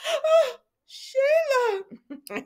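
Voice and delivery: high shrieking oh, breaking into laughter; laughing shocked cry. Retold or imitated. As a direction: shriek her name in shocked delight. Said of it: "she said".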